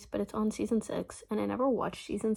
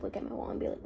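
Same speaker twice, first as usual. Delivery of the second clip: ordinary talk, nothing marked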